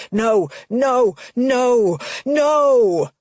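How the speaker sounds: repeated no growing into a scream; rising shout. Acted. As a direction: build the no's into a full panicked yell. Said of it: "Dad's voice rises to a shout".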